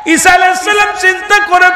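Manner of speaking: high shouted sung cries to Allah; impassioned preaching. Acted. Preacher wails the prophet's grief to the gathering, high and shouted. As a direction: cry out the story at full force.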